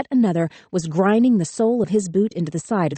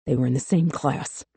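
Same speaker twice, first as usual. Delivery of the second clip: low angry hiss; 4 semitones lower, 3 dB quieter than usual. A narrator's reading. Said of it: "someone hissed between gritted teeth".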